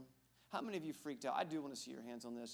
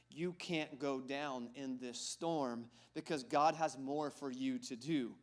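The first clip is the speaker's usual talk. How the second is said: slow preaching emphasis; 8 dB louder, slower than usual. Acted.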